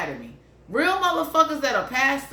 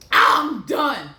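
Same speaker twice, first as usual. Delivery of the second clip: mock finished groan; 3 semitones higher, 5 dB louder than usual. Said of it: "Usually when somebody rush".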